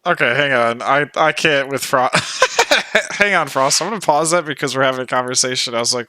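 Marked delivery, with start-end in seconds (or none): 2.1-3.1 high burst of giggling; helpless laugh. Real, not acted. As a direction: break up laughing at the song. Can't cope with the song.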